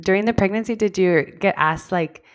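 ordinary delivery

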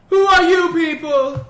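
ordinary talk, nothing marked

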